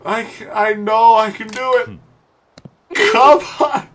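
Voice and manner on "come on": raised-pitch cry that drops in volume; anguished pleading. Real, not acted. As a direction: burst out, then whimper in despair. Pleading with the ball.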